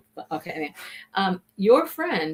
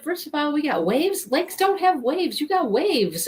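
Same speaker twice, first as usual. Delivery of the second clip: mock-baffled objection in a higher pitch; imitated protest; 8 semitones higher than usual. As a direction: lightly voice the man arguing his point. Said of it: "He said".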